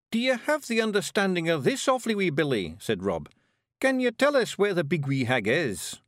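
high, brisk Scottish little warrior; Scottish pictsie leader. Acted. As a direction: bark it out like a tiny Scots chieftain. Rob asks Billy.

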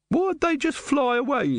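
high, slow demanding woman. A narrator's reading. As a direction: demand an answer. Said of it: "the woman demanded".

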